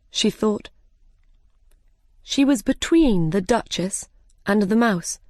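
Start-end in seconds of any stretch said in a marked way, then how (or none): none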